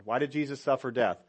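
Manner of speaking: ordinary delivery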